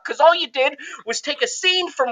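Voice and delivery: ordinary talk, nothing marked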